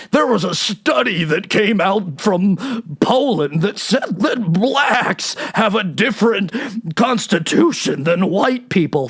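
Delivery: slow, warbly mock of the official; health czar impression. Comedian mocking the health czar's study claim, slow warbly impression. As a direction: drag it out in his shaky cadence.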